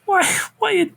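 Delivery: high-pitched disbelief; incredulous high mock question. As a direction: squeak it out in disbelief. Mocking character's dumb choice.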